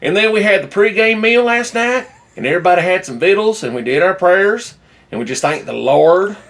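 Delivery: mock a phony Southern accent, stretching the vowels; fake Southern accent. Podcaster imitating a coach recalling team dinner, fake Southern accent. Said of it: "This fake Southern accent".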